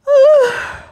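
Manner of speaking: high, loud yawn; big squeaky yawn; cat's yawn. A narrator's reading. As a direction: yawn out loud as a sleepy cat. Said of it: "Cat yawned again".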